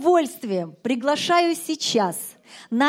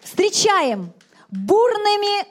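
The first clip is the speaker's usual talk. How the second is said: grand entrance announcement; 5 semitones higher, 4 dB louder than usual. Real, not acted. Host introduces singer to stage.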